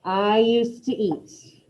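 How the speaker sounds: We used to talk and I used to eat read slowly, each word separate; teacher dictating phrases to the class; slow dictation. Shown as a demonstration. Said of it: "first two".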